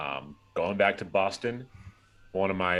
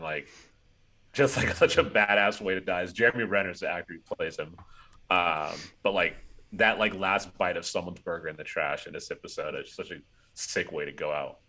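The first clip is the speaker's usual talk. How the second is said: usual speaking voice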